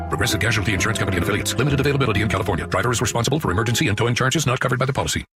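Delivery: rapid-fire legal disclaimer; super fast ad read. Acted. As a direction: rattle off the fine print fast.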